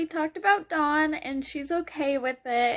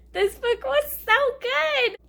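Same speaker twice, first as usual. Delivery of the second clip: gushing praise; 8 semitones higher than usual. Real, not acted. Final gushing verdict.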